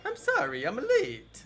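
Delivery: very high cartoon game-hero squeal; hammed-up falsetto, swooping up at the end; Mario. Streamer hired as Mario, high cartoon impression, near laughing. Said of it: "auditioning for Mario".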